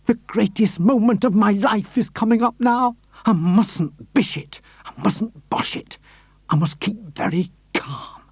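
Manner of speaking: steady yourself aloud; a touch of nerves talking to himself; lightly voiced nervous man. Hoppy nervous on stairs.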